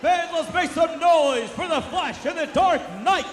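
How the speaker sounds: high, loud final-bell call; excited shout. Real, not acted. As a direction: whip the crowd up at the bell. Final bell, crowd hype.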